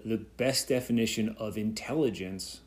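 usual speaking voice

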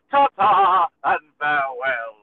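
lightly voiced pompous Englishman taking his leave; mock grand farewell. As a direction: bid goodbye like a departing gentleman. Arrested man leaving.

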